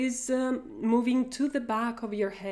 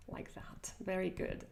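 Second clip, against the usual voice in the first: soft unvoiced whisper; 2 semitones lower, 13 dB quieter than usual. Real, not acted. Teacher whispers praise to following students. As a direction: breathe the praise out, no voice.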